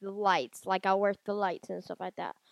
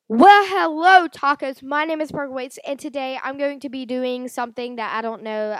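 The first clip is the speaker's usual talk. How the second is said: YouTube intro; 3 semitones higher, 8 dB louder than usual. Acted. Vlogger retrying intro for viewers, upbeat host intro. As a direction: kick off the video like a channel host. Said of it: "I'm gonna do my intro for YouTube".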